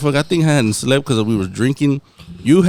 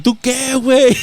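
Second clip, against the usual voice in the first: higher, playful "tú qué, güey" catchphrase; mock Mexican challenge; 8 semitones higher than usual. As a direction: toss the line like a running joke. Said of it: "we were like".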